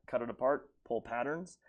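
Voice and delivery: ordinary talk, nothing marked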